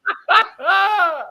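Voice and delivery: sudden high burst of laughter. Real, not acted. Big laugh at the insult.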